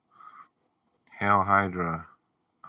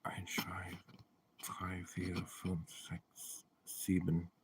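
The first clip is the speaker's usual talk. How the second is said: whispered counting; 12 dB quieter, slower than usual. Real, not acted. Counting in German under breath.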